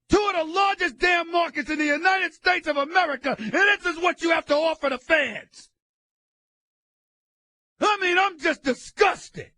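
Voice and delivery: loud, high outburst of frustration; disgusted shout. Real, not acted. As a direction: vent it all at full volume. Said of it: "personified emphatically the frustration".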